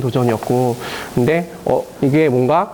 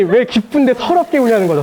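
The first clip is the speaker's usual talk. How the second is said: normal talking voice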